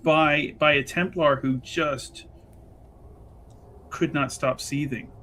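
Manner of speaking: ordinary delivery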